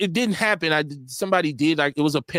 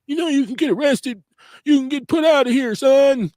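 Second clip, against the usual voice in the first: stern coach; 10 semitones higher, 4 dB louder than usual. Retold or imitated. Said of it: "talk to the coaches".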